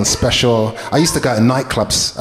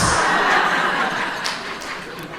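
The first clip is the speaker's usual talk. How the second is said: breathy laugh; 6 dB quieter than usual. Real, not acted. Laughs at own joke.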